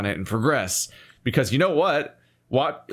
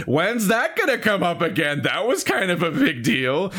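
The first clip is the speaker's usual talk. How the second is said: exasperated wondering; 6 semitones higher than usual. Retold or imitated. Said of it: "I'm like".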